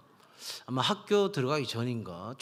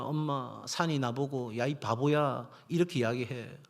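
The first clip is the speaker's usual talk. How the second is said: usual speaking voice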